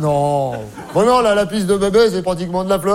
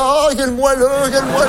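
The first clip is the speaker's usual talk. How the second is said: higher, swooning mock delight; mock rapture; 5 semitones higher than usual. Comic imitating guests who notice nothing but chocolates, higher mock rapture. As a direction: gush over it as if melting with pleasure.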